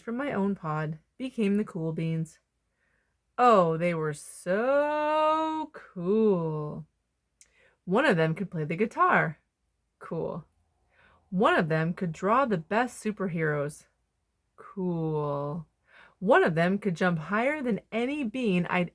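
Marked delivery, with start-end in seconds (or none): 3.4-6.8 very slow, drawn-out awe; longing, lingering sigh of awe; dreamy admiration. Acted. Admiring the cool beans. 10.0-10.4 soft, low admiring cool; a quiet, impressed aside; hushed cool. Acted. Echoing cool after each talent. 14.6-15.7 soft, low admiring cool; a quiet, impressed aside; hushed cool. Acted. Echoing cool after each talent.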